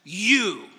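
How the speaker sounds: "You" drawn out and punched on its own; emphatic YOU. Shown as a demonstration. Preacher shows how the text actually begins, emphatic "You". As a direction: hit "You" hard, as a word standing alone. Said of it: "The emphasis is on the word".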